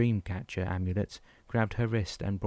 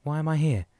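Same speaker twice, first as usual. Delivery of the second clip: agitated demand; 7 semitones higher, 5 dB louder than usual. A narrator's reading. Patient demands answers.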